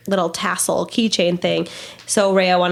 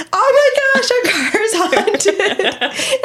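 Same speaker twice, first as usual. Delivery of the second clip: mock panic; 12 semitones higher, much slower than usual. Mom retelling the van door reopening, mock panic. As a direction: squeal it in hammed-up alarm, laughing.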